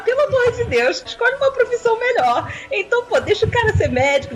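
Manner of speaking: high pleading disbelief; exasperated plea. Real, not acted. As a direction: beg him in exasperation. Apelo exasperado em tom alto. (apelo exasperado)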